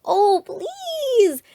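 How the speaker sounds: high, loud pleading cry; begging shout. A narrator's reading. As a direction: plead at the top of your voice. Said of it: "they shouted".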